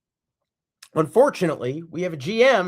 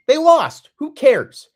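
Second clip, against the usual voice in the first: louder and higher, dismissive blast; exasperated outburst; 8 semitones higher, 9 dB louder, slower than usual. Real, not acted. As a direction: throw it away in a loud, disgusted burst. Fed-up fan rant.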